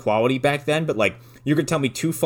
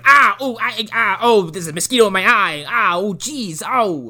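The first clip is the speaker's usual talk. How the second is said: high, yelping comic pain; hammed-up high ows and ahs; French Stewart impression; 9 semitones higher than usual. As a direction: imitate a goofball being stung. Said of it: "he's still French Stewart the whole time".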